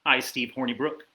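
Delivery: usual speaking voice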